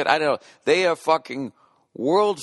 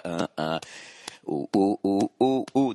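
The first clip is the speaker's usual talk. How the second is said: ordinary talk, nothing marked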